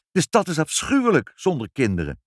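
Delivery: indignant mother crying out. A narrator's reading. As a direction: protest it hotly. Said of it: "riep moeder verontwaardigd".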